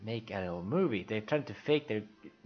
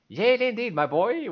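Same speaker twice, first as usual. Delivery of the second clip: high, loud gleeful exclamation; excited Lofty; 12 semitones higher, 8 dB louder than usual. Acted. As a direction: crow with delight at the jail. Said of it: "is very excited to be in jail".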